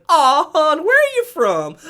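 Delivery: loud, very high put-on lady voice; mock Kentucky lady. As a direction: exaggerate a condescending local's question, high and loud. Retells Kentucky lady questioning her.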